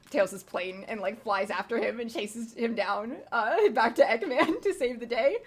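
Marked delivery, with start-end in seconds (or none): 3.3-5.4 higher, laughing through the words. Real, not acted. Player recounting the chase back to Eggman, laughing. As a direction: break into giggles while telling it.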